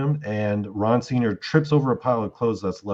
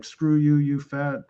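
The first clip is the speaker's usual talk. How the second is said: retold yelling; 5 semitones higher than usual. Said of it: "screaming all night long".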